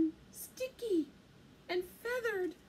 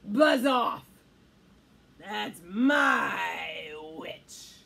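loud, low beast roar; monstrous growl; 7 semitones lower, 7 dB louder, much slower than usual. A narrator's reading. Horrible beast warns off the dragon, loud growling. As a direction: growl it out, stretching the last words. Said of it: "a yowl and growl and croak and shriek".

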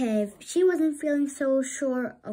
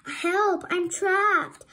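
high, loud cry for help; shouting for help; 9 semitones higher, 5 dB louder, much slower than usual. A narrator's reading. Trapped sister calling for help, loud shout. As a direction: scream for help. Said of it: "she shouted".

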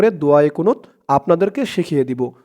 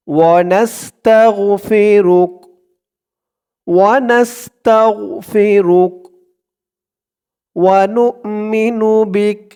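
measured teaching recitation; slow devotional recitation; 2 semitones higher, much slower than usual. Shown as a demonstration. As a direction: recite each phrase slowly for repetition. Line-by-line recitation.

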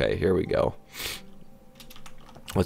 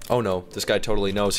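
ordinary delivery

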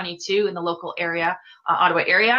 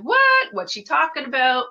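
incredulous listener; 5 semitones higher than usual. Pretend. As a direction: play a bewildered viewer reacting in disbelief. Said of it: "you're like".

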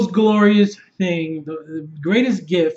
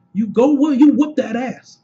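higher, louder egging-on; hyped cheering; 5 semitones higher, 4 dB louder than usual. Retold or imitated. Fan cheering women power, loud hyped egging-on. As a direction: urge them into the fight with punch.

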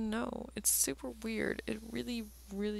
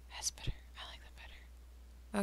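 whispering; 11 dB quieter than usual. Real, not acted. Muttering to himself while drawing.

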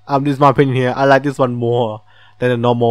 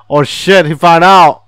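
louder, higher panicked cry; alarmed outburst; 4 semitones higher, 8 dB louder than usual. Real, not acted. Player panicking as the king catches the escape, loud alarm. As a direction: yelp it out in alarm.

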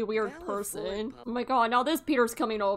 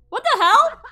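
high, loud outraged shriek; shocked yell; 12 semitones higher, 10 dB louder than usual. Real, not acted. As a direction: yell it in disbelief. Intruders in the house.

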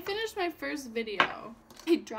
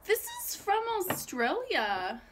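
normal talking voice

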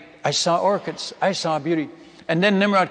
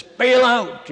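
loud, high call to jump; 7 semitones higher, 5 dB louder than usual. Retold or imitated. Chief warning men as floor vines snap, loud high call. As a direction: yell the warning to everyone.

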